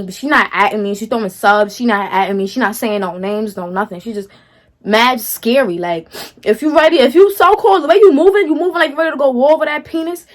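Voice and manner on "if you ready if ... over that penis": heated callout. Real, not acted. Calling out the rival directly.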